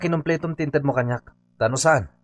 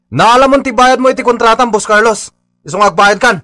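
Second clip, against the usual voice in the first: angry demand; 7 semitones higher, 7 dB louder than usual. Acted. Worker confronts boss over unpaid wages.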